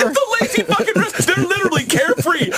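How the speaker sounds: laughing in disbelief. Real, not acted. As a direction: crack up while saying it. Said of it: "laughing disbelief".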